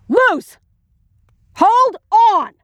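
high-pitched urgent call after her. Acted. Calling after a woman who starts running, urgent high shout. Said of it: "I called".

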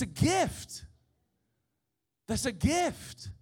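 loud, high, drawn-out proclamation; full-force preaching. Real, not acted. Preacher proclaiming the cow gift that saved generations, full-force preaching. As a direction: thunder it out to the congregation.

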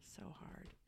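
a breathy aside; whisper. Real, not acted. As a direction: whisper it like a secret.

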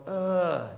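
short loud fed-up ugh; frustrated ugh; exasperated groan. Retold or imitated. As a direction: groan at the mess you're in. Groaning at a hard situation.

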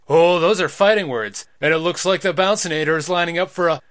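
loud, fast ringside hype; fighting game announcer. Acted. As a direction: call the big move. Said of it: "Stop using an announcer voice".

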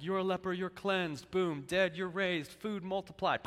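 tossing off miracles like a cartoon hero; mock superhero Jesus. Mocking superhero-Jesus picture.

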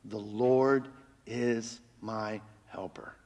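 slow, soft whisper; hushed confidence. Acted. Preacher softly speaking the verse as a personal confession. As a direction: breathe it like a quiet vow.

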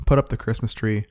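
ordinary talk

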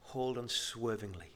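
soft, low "Hold unswervingly"; gentle, hushed "Hold unswervingly"; quiet quote. Shown as a demonstration. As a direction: say it softly, almost to yourself. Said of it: "And the writer says".

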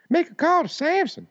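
high squeaky rapper voice; hammed-up high rapper line; rapper impression. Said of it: "it was Chappelle doing, uh, that rapper dude".

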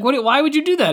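mock-shocked what, pitched well up; indignant disbelief, voice jumping high; incredulous. Nina shocked at Malcolm's errands, a high incredulous outburst. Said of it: "And she was like".

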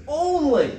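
"Only" pitched high, loud and on its own; emphatic ONLY. Retold or imitated. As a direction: punch "Only" as one weighty word. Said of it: "it is double emphasis".

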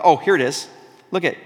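normal talking voice

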